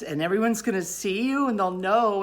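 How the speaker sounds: retold dread of everyone knowing; worked-up storytelling panic; acted panic. Acting out panicked shame in a story.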